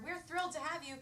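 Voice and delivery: usual speaking voice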